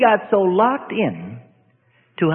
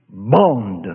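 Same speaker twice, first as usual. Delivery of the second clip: much lower, curt correction; a low voice from inside correcting the name; demon voice; 10 semitones lower than usual. Retold or imitated. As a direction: drop deep and correct him flatly. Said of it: "a voice came out of that mouth and said".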